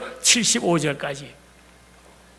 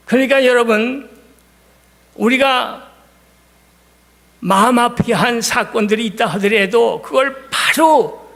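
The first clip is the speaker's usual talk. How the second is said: shout; 5 semitones higher than usual. Real, not acted. Preacher exhorting the congregation.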